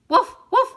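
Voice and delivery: high, loud woofing bark; loud yappy dog bark. A narrator's reading. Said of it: "ladró el perro".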